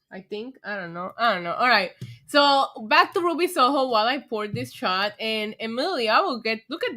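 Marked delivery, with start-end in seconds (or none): none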